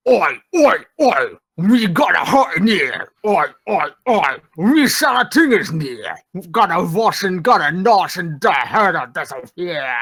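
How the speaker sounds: gruff oafish troll grunting Oi. Pretend. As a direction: play a dim hungry troll. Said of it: "Do it in your troll voice".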